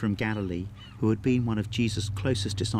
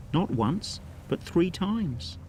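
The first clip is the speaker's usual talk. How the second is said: "three times" drawn out slowly and pitched higher, "times" falling at the end; slow emphatic climax; 6 semitones higher, much slower than usual. Acted. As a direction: lean hard and slow on "but three times", high, landing down on "times". Climax of Peter's denial.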